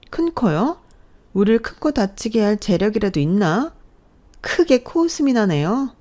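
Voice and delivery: high, scornful mock laugh in the voice; scoffing. Sister-in-law scoffing at mother-in-law's warning. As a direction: toss the words back with contempt.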